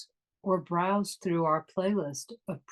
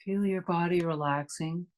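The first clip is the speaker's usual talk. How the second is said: guided meditation. Acted. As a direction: ease them inward, unhurried and calm.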